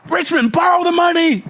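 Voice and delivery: high, loud, slow shout of advice; shouted advice to a stalling friend; mock shout. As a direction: yell it across the room.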